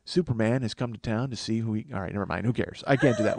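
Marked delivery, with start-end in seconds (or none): none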